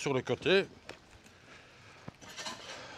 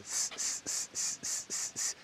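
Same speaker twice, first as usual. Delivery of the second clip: cicada buzz; 12 dB quieter than usual. Retold or imitated. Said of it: "La cigale".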